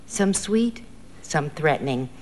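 plain reading voice

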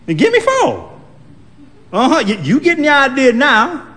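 put-on very high, pleased old lady; smug as she gets her way; bossy old woman. Old woman ordering from the clerk, high and smug.

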